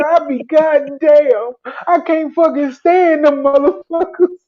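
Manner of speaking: imitating a fed-up trooper; disgusted trooper. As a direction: drag it out in disgust.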